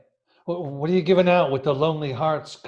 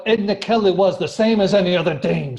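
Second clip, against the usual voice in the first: sudden angry outburst, louder and higher; flaring up; 5 semitones higher, 6 dB louder than usual. Acted. Keegan to reporter about Edna, angry outburst. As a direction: snap at the reporter in bitter anger. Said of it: "he flared up so suddenly".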